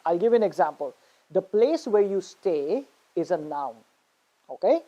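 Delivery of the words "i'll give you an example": normal talking voice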